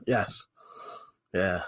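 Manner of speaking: ordinary delivery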